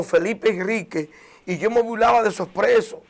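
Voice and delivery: ordinary delivery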